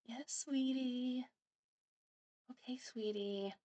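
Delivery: slow, soft, sugary forced reply; fake sweet. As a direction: smile through it, drawn out and placating. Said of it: "you put on a smile".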